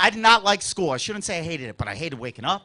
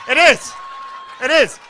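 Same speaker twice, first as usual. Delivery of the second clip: emphatic; 5 semitones higher, 6 dB louder than usual. Retold or imitated. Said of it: "'cause you're like".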